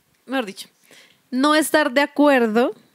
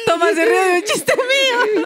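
high, squeaky laughing speech; real giggling fit over the words; helpless laughter; 8 semitones higher than usual. Real, not acted. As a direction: try to get it out while cracking up. Can't stop laughing while explaining.

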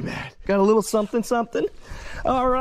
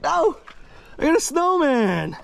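ordinary delivery

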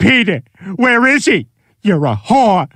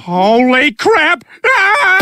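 shocked; 10 semitones higher, much slower than usual. Acted. Shocked discovery.

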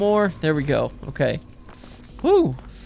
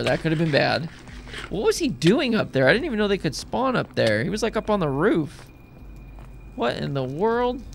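ordinary talk

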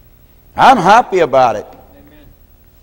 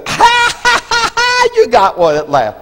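mocking laugh. Old captain mocking the preacher who finally caught one, cackling high. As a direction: laugh at him in a high put-on voice. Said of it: "And then he goes".

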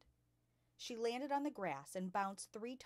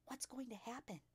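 soft, higher whispered question; whispered back; 6 semitones higher, 7 dB quieter than usual. A narrator's reading. Lavender asks her friend what is coming, whispering. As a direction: ask it in a whisper. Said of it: "Lavender whispered back".

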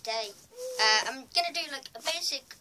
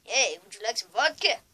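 loud put-on Russian accent; hammed-up Russian accent, loud; Russian man; 12 dB louder than usual. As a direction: call it out as a mock Russian man. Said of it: "a Russian man".

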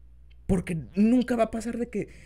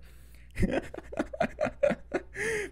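high airy giggle; light unvoiced laugh; breathy laugh. Real, not acted. Laughs at own joke.

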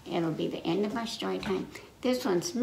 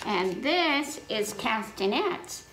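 ordinary delivery

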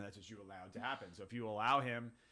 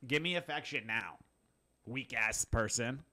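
loud brash dog voice; tough dog voice; 3 semitones higher, 12 dB louder than usual. Retold or imitated. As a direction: bark it out like a bored dog. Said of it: "The dog's version is".

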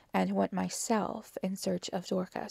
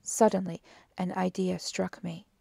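plain reading voice

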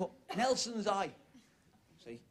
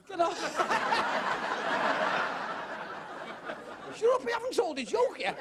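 higher, laughing through the words; 8 semitones higher than usual. Real, not acted. Comedian laughs back at the cheering audience, laughing. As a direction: laugh off the applause.

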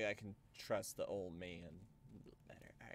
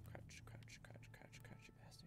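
whispering; 16 dB quieter than usual. Real, not acted. Urging character to crouch.

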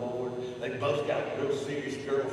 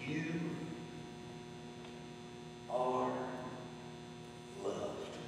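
hushed; 12 dB quieter, slower than usual. Real, not acted. Soft slow closing words.

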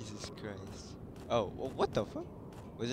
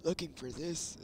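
put-on dog character voice, pitched higher; teasing character voice, pitched up; game dog's voice; 5 semitones higher than usual. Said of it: "I just feel like that's how he sounds".

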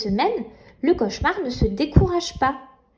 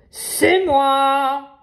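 sneering panto villain; 3 dB louder than usual. Acted. Nightmare returns.

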